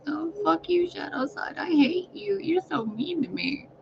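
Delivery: high, whiny little complaint voice; mock pouting in a tiny high voice; light side. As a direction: squeal it high and soft, like a hurt child. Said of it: "I was in my light side".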